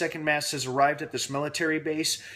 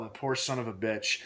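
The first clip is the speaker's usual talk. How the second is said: normal talking voice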